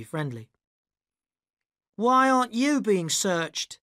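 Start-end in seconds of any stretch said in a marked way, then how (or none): 1.9-3.8 high, slow shout across the line. A narrator's reading. Arabella shouting to the narrator. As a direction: call out over the crowd. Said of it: "Arabella shouted to me".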